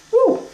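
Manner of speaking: short, very high "woo"; high whoop. Real, not acted. Cook signs off a cooking step to viewers, high whoop. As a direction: squeal a quick falsetto woo.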